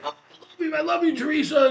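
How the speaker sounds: very high-pitched mock gushing fan; overexcited gushing. Overexcited lady jumping from her seat to hug Teresa, gushing. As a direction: imitate an overexcited woman, voice shooting way up. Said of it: "overexcited lady".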